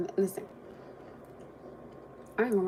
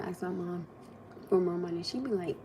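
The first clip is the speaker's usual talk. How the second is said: ordinary delivery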